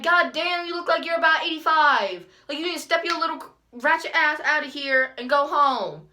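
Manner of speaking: loud, high-pitched sassy rant; imagined telling-off. Retold or imitated. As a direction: let rip with an acted-out outraged insult. Imagined telling-off she didn't say.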